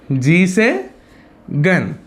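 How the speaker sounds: ordinary delivery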